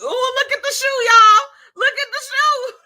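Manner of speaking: high, loud laughing shriek; cackling high excited cry; laughing squeal. Real, not acted. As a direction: squeal with delight at the joke. Reacting to comment, laughing.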